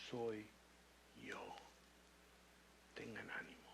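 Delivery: soft, low whisper of comfort; whispered calm to scared friends; whispered reassurance. Acted. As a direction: hush them gently as Jesus. Jesus calms the disciples.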